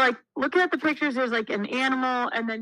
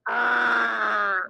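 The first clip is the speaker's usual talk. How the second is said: louder copied "Argh!", a little shouted; toddler's copied growl; 3 dB louder than usual. Retold or imitated. Babysitter imitating the toddler copying her, shouted "Argh!". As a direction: shout the growl back like a copying two-year-old.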